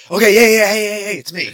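loud, high rapid-fire interruption; impatient cut-in. Retold or imitated. Caller interrupting the phone greeting, loud and impatient. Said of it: "everyone cuts him off".

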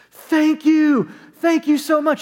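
mock the man's overjoyed shouting of thanks; retold scream. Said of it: "He started screaming it".

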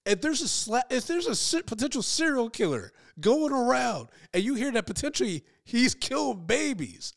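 high-pitched incredulous rant; exasperated disbelief. Acted. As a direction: spell it out in mock outrage. Mock outrage at a weak reaction.

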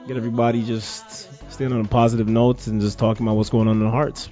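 ordinary talk, nothing marked

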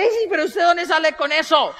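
high, loud, drawn-out challenge; amused incredulous mother. Retold or imitated. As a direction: imitate a woman protesting through amusement. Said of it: "La señora se ríe". (señora incrédula y divertida, voz aguda)

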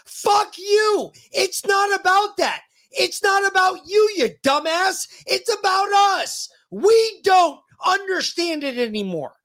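high-pitched, indignant outburst; angry rant. Real, not acted. Host rants at a senator on behalf of the people, angry and high. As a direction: hammer it out, pitch pushed right up.

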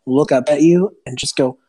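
ordinary delivery

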